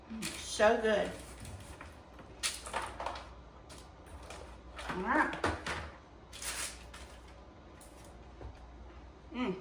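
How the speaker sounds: talking with a mouthful of crunchy snack; crunching snacks. Real, not acted. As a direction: chew and talk through the crunch. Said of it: "I'm crunching on my snacks".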